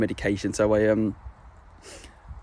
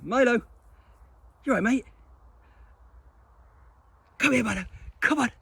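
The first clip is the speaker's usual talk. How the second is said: much higher, coaxing voice to a dog; high sing-song pet talk; high dog-directed voice; 13 semitones higher, faster than usual. Real, not acted. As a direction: call the dog over sweetly. Talking to his dog Milo.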